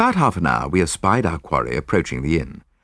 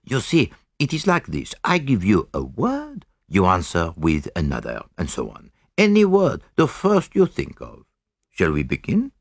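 Poirot; 2 semitones higher than usual. Acted. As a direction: explain the rules step by step in a measured foreign-sounding voice. Poirot explains the experiment.

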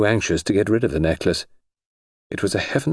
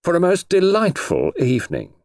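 ceremonious, pitched-up gallant thanks; highwayman manner; 10 semitones higher than usual. A narrator's reading. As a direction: play the courtly highwayman taking his leave. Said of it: "in his best highwayman manner".

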